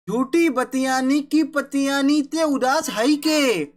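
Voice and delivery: put-on slow, measured recitation of old verse; poem recitation. Shown as a demonstration. Teacher reciting the first kavitt to his pupils, slow and measured. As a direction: chant each line slowly, savouring it. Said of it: "अभी पहला कवित मैं आपके सामने पढ़ूंगा".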